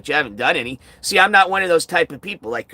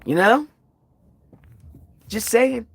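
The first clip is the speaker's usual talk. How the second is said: borrowed sassy quip, pitched up; mocking catchphrase; 8 semitones higher than usual. Streamer mocking a chatter with his own catchphrase, sassy. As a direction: toss it off in a chatter's smug tone. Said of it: "steal one of your butt buddies' little expression".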